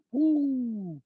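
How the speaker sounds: a high, startled woo of amazement; high, amazed exclamation at her words. Retold or imitated. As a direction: let out a high, stunned whoo. Reacting to her revelations.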